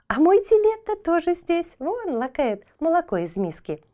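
put-on high, bright little girl; a girl pointing out her cat; excited girl voice.

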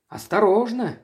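sharp high warning cry; shout. A narrator's reading. Priestess warns the onlookers away with a loud cry. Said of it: "крикнула она".

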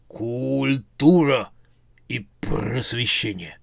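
very slow, otherworldly zombie reply. A narrator's reading. Zombie foreman tells the witch the trip's purpose, very slow. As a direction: drag the words like a dim zombie.

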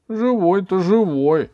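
high, loud, drawn-out reply; slow whiny admission; reluctant schoolboy. A narrator's reading. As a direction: drag it out in a boy's high voice. Ivan feigns injury, answers the girl.

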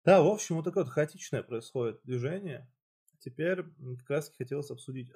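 usual speaking voice

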